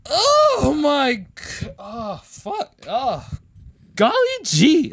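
sudden high loud yelps; weird outburst. Shown as a demonstration. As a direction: blurt out something bizarre. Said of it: "just do something really fucking weird".

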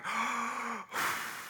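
a big breathy inhale of relief; gasp of relief. Retold or imitated. Dad hearing everyone's good, soft relieved gasp. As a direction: let the fear out in one sharp breath.

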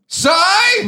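high, loud shout of the name. Pretend. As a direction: yell the first half for the listener. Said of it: "So three, two".

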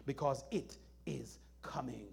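unvoiced hushed whisper. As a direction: whisper the warning. Whispered warning.

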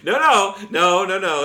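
high, loud pleading no; loud protest. Pretend. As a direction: cry out no in mock alarm. Mock protest.

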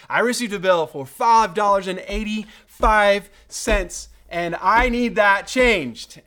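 loud, high and forceful demand; indignant complaint. Retold or imitated. Customer confronting the propane company, indignant. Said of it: "with indignation".

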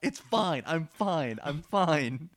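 exasperated, insisting she's fine; exasperated Serenity. Retold or imitated. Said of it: "Serenity would be like".

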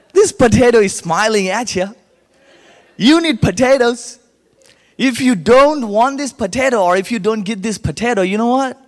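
loud, high street-seller patter; spirited sales pitch. Acted. Boy selling potatoes on the street, spirited pitch.

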